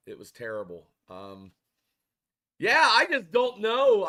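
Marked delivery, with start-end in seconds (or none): 2.6-4.1 frustrated outburst. Real, not acted. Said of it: "I'm so fucking mad".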